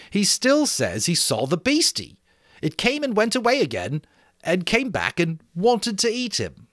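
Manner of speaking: lightly voiced schoolboy explaining; Piggy interpreting to the assembly; boy Piggy, earnest. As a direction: speak for the frightened child.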